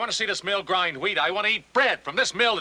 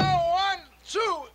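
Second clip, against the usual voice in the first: straining call; 12 semitones higher, much slower than usual. Acted. Heaving together.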